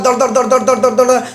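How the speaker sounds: rapid-fire mouthed gun noise; imitated gunfire. Seminar speaker acting out a militant's shooting, imitated gunfire. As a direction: rattle it off like a machine gun.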